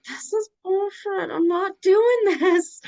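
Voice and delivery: a high, loud, teary outburst; crying protest. Retold or imitated. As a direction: wail it out through tears. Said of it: "I'm like".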